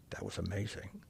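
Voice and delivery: whispered in awe, no voice; hushed whisper. Retold or imitated. Cashier whispering to Rick after the stranger leaves. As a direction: breathe it like a secret.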